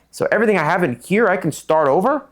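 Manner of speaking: high, rising question seeking confirmation; retold incredulous asking. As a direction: ask it as if hardly believing it. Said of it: "I remember I kept asking that".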